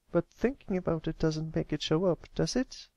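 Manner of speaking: put-on a young filly asking anxiously; young filly Applebloom. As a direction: sound like a worried young pony. Applebloom asks.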